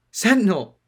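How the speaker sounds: high, loud yell of the name. Acted. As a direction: shout to stop her. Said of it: "she yelled".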